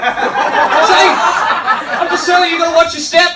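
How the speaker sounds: loud, high-pitched warning; hammed-up, worked-up yelling; mock alarmed rant. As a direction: shout it like an urgent public warning. Mock rant warning about snakes.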